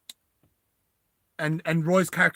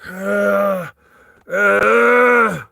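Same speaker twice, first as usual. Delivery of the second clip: loud, raised strangled groan; Russian guy's groan; 4 semitones higher, 8 dB louder than usual. Acted. Russian pinned by his ears, loud strained groan. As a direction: groan loudly, rising in strain. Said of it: "the Russian guy's like".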